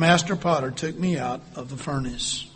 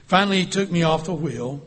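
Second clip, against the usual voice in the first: ordinary delivery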